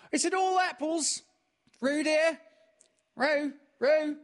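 high, loud worried mother's call; anxious mother calling. A narrator's reading. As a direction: call out to her child with worry. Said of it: "called anx-, Kanga anxiously".

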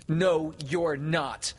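loud, slow, high denial; stubborn denial. A narrator's reading. Peter refuses to believe Wendy is married, loudly stubborn. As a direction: deny it hard, drawing out each word.

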